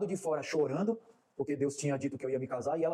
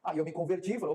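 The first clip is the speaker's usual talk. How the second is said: normal talking voice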